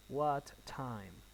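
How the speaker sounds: "What time" in two spaced words, falling; measured model question, dropping on "time"; falling intonation. Shown as a demonstration. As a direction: let "time" sink at the end. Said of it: "falling intonation".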